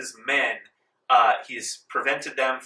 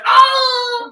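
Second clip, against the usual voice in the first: pained cry; 13 dB louder than usual. Acted. GM voices stabbed soldier crying out.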